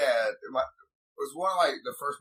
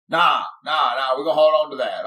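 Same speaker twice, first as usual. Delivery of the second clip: louder, quick dismissive dad; dad refusing; 8 dB louder than usual. Retold or imitated. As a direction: brush off the son, fast and firm. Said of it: "He's like".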